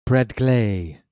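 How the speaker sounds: "Brad Glay" with a drawn-out, falling Glay; stress on GLAY. Shown as a demonstration. Announcer likening it to fancy perfume, lingering on "Glay". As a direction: savor "Glay" like a perfume name. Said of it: "sounds like some fancy perfume".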